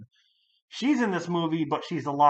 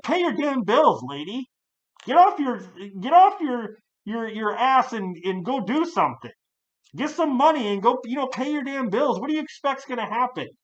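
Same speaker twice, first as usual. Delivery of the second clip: put-on pitched up and loud, telling the old lady off; angry yell; 8 semitones higher, 4 dB louder than usual. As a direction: bark at the deadbeat like you've had enough. What I would have said.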